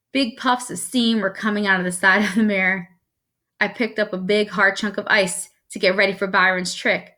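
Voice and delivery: plain reading voice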